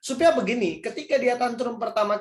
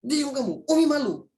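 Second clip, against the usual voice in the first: put-on mother snapping at her child, a bit louder; scolding mother; 5 semitones higher, 5 dB louder than usual. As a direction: hiss at the child in embarrassment. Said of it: "Itu Uminya bilang".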